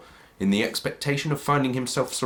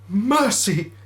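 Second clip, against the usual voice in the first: high, louder terrified plea; begging for mercy; 11 semitones higher, 5 dB louder than usual. Acted. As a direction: cry out for mercy on your knees. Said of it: "he said".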